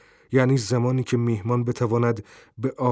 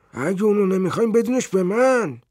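put-on eager old man; 9 semitones higher, 4 dB louder than usual. A narrator's reading.

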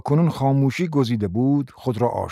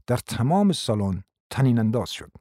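ordinary delivery

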